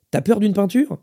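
playful, kindly mockery; gently mocking. A narrator's reading. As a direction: tease affectionately, voice lifted. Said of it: "en se moquant avec gentillesse".

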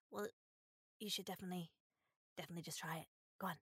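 soft whispered urging; whispering. Real, not acted. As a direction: coax them in a whisper. Hushed urging over last cookie.

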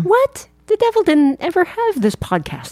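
high, baffled disbelief. Real, not acted. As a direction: squeak out the disbelief. Baffled reaction.

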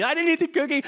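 put-on high whiny child denial; whiny kid. Kid repeats the denial to mom, high whine. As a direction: pitch it up like a guilty kid. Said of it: "they get that weird thing that they do".

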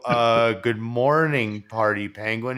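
slow, hesitant, drawn-out; dazed owner answering his penguin, slow; stunned slow greeting. Pretend. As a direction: greet it slowly, not believing it's talking. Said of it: "that's when you go".

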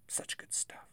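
breathy whispered aside; whispering. Real, not acted. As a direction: whisper it to yourself.

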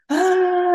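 loud, high mock wail; imitating toddler wail. Mimicking her son crying on the stairs, high wail. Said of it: "he was just wailing".

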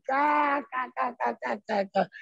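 mock cackle, lightly voiced; imitated laughter. Coach laughing at the broke kid, mock cackle. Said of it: "começou a rir".

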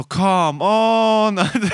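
loud, high, long-stretched protest; exasperated mock yell. Boyfriend hearing it was sleep talk, exasperated outcry. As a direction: drag it out in hammed-up disbelief. Retold exasperation at girlfriend's answer.

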